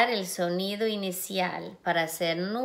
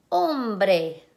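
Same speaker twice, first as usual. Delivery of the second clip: Hombre with the last syllable bre punched louder; stress on the final syllable; 4 dB louder than usual. Shown as a demonstration. Teacher to class, demonstrating final-syllable emphasis. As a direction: hit bre in Hombre hard. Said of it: "con énfasis al final".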